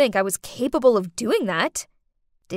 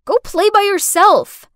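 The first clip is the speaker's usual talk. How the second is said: much higher and louder, a sharp dismissal; shouted; 9 semitones higher, 9 dB louder than usual. Acted. Babysitter shouting at the girl to leave her alone, higher and louder. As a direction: send her away with a shout. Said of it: "I shouted".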